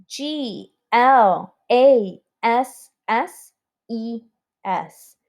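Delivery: slow, letter-by-letter spelling aloud. Shown as a demonstration. Teacher spelling always for the class, slow letter by letter. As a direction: space out each letter for the class. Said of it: "let's spell the word glasses".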